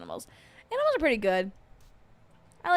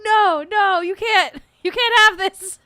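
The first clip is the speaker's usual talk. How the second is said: high, loud playful scolding; mock scolding; 11 semitones higher, 12 dB louder than usual. Owners telling rough dog he can't have the toy, loud high scolding. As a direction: tell the dog off in a squeaky shout. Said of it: "We're like".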